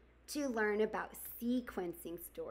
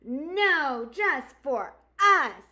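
loud; 5 semitones higher, 11 dB louder, much slower than usual. Acted. Animals refuse the newcomer.

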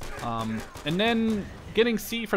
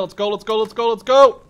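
building from eager to a loud cheering shout; rising victory chant; hyped cheer; 6 semitones higher, 12 dB louder than usual. Real, not acted. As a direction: pump yourself up after the kill, getting louder each time. Teammate kill, cheering himself on.

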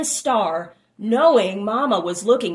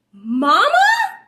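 high, loud hopeful call; crying out; 11 semitones higher, 8 dB louder than usual. A narrator's reading. Child cries out to mother, high and loud. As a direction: cry out for mama across the plain. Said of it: "cried little one".

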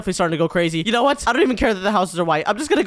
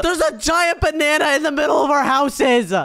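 high-pitched, exasperated cry; exasperated outburst; 4 semitones higher than usual. Acted. Pointing out the giant banana to friends, exasperated outburst. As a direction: blurt it out in disbelief.

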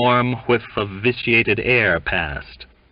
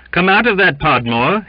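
high, loud command; raised voice of command; ordering aloud; 11 semitones higher, 7 dB louder than usual. A narrator's reading. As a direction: call him out with authority. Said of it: "he ordered aloud".

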